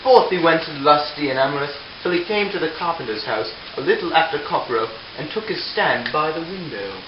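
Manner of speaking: ordinary delivery, nothing marked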